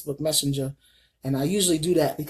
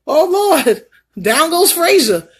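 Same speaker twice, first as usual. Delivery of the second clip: mock dramatic exclaim; 11 semitones higher, 10 dB louder than usual. Retold fall, mock announcer.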